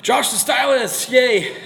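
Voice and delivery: high, loud, drawn-out cheer; delighted whooping shout-out; excited greeting. Real, not acted. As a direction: hail a favorite friend with delight. Greeting a favorite viewer arriving.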